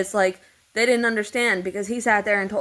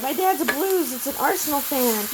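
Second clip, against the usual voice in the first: mocking him; 7 semitones higher than usual. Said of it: "he'd be like".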